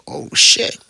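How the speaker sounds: whispered surprised exclamation; a voiceless gasp of disbelief at being accepted; surprised oh shit. Retold or imitated. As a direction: breathe it out in disbelief.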